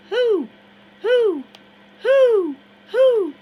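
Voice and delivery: very high, very loud hooting like an owl; loud owl hoots. Acted. Harrison hoots across the water, very loud and high owl calls. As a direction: hoot as loud and high as you can. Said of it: "gave out a loud hoot".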